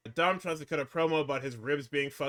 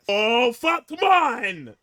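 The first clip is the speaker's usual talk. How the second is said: much higher and louder, laughing through it; hyped yelling; 8 semitones higher, 7 dB louder, slower than usual. Real, not acted. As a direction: shout like you're ready to run through a wall. Fired up over Goldberg legacy.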